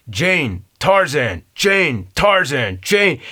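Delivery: hammed-up caveman, jabbing each word; mock Tarzan shout. Tarzan jabbing Jane while naming them both, hammed-up loud. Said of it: "you have to punch the person".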